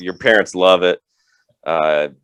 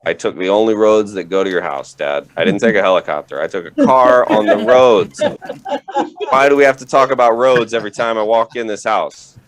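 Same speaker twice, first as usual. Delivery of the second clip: loud, fed-up son answering dad; exasperated, louder and louder; 4 dB louder than usual. Retold or imitated. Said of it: "It's like".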